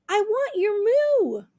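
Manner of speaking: high, loud pleading; turkey begging the cow for a moo, pleading. Acted. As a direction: beg the cow for her moo. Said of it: "Toodles begged".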